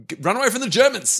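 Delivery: high, fast panicky call; panicked crowd. Retold or imitated. Villager warning others about advancing Germans, fast panicky cry. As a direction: urge everyone to run, rushed. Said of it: "everyone's just like".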